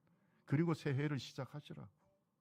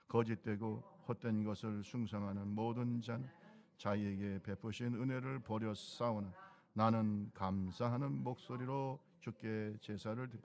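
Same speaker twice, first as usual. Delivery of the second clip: scripture reading; 5 semitones lower than usual. Acted.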